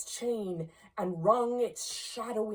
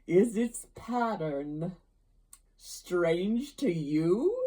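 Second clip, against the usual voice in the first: loud, slow, drawn-out ghostly voice; solemn ghost; 2 semitones higher, 7 dB louder, slower than usual. Acted. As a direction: toll each phrase out heavily, like a haunting spirit. Ghost's speech in narrated story.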